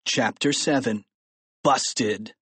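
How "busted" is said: ordinary delivery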